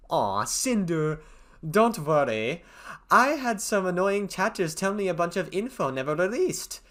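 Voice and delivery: thick mock German accent. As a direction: read it in a hammy German accent. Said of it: "stop doing my German accent".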